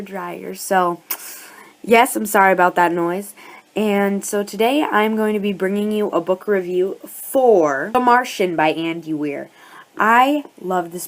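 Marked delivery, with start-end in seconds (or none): none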